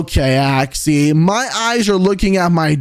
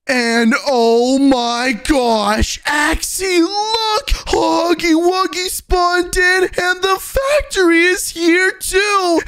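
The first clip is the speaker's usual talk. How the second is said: excited shock; 9 semitones higher than usual. Acted. Huggy Wuggy suddenly appears.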